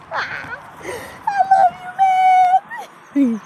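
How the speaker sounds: very high, squeezed mock declaration; hammed-up bro affection. Joking about saying love to a buddy, hammed-up squeaky affection. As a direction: squeak it through the giggles.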